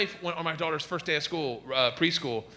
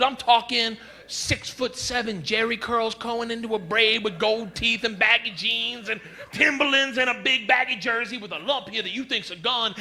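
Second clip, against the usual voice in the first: ordinary talk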